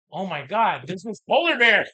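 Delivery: high, loud, giddy cry of discovery; excited mock discovery. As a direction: play the thrilled conservationist spotting the bear. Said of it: "conservationists are like".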